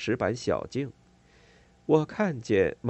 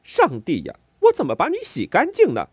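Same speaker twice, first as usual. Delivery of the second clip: high, loud, fast scolding; exasperated yell; 10 semitones higher, 8 dB louder than usual. A narrator's reading. Neighbour yelling at her painted daughter. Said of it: "接下来他又去吼南希".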